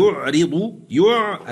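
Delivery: يعرض intoned with a light ر, then يع alone; recited demo. Shown as a demonstration. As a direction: chant يعرض and isolate يع. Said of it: "العين ساكنة قبل الراء المكسورة".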